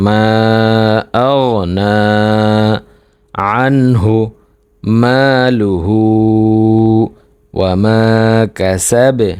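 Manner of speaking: "মা আগনা আনহু" piece by piece, long pauses between; broken-up recitation. Shown as a demonstration. Teacher reads the whole verse in pieces, pausing after "আগনা", "আনহু", "মালুহু". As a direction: recite "মালুহু ওয়া মা কাসাবে" in separate drawn-out chunks. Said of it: "একটু ভাঙা ভাঙা উচ্চারণে পড়ি".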